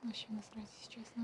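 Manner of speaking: hushed whisper. Real, not acted. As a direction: whisper the aside. Hiding, whispering.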